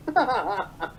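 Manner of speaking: short laugh at own joke; amused laugh. Real, not acted. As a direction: chuckle after the irony.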